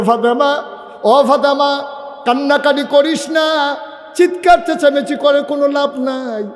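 high, chanted appeal to a daughter; preacher voicing a father's urgent plea; plaintive pleading. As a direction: implore a weeping child to stop. Father tells daughter stop crying.

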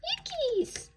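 very high, squeaky frightened cry; scared yickies. A narrator's reading. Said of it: "said Potts".

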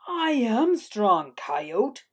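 slow, puffed-up boast; proud Mule Deer. A narrator's reading. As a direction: puff out your chest and declare it slowly. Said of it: "puffing out his mighty chest".

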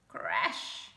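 breathy crash noise; unvoiced crash effect; crash sound. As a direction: whoosh out a crash.

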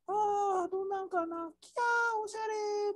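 very high, squealing delight at the door; excited client voice. Pretend. Teacher acts a delighted client opening the door, high squeal. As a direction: squeal like a thrilled homeowner peeking in. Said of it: "っていう状態の声枯れてきた".

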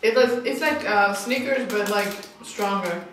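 chewing while talking; eating chocolate while speaking; mouth full. Real, not acted. Seen 0: Speaker unclear; girl on left looks to be chewing throughout.

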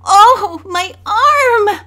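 high, loud cry of pain. A narrator's reading. Girl who just fell off the boxes, crying out in pain. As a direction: cry out as the arm is wrenched. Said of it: "Bess exclaimed, tears coming to her eyes".

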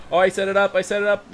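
high, loud, urgent repeat; excited callout. Real, not acted. Gamer calling out a setup mid-play, excited. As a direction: call it out loudly to a teammate.

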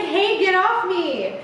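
mock annoyed protest. A narrator's reading. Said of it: "And Not's just like".

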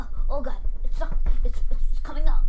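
loud alarmed shouts, stammering; panicked yelling. Real, not acted. Player yelling in panic at the game, loud and alarmed. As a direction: yell in real panic as it sticks.

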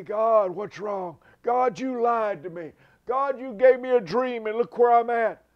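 full-throated anguished shout at God; mock screaming complaint. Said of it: "he wasn't screaming at God".

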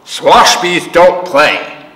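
put-on gruff old man refusing, slow; grumpy refusal. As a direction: shut her down slowly.

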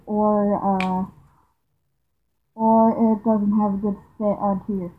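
muffled behind rubber mask; speaking through filter respirator; through a gas mask. Real, not acted. Seen 2: Full gas mask with filter worn on face throughout the labelled words.

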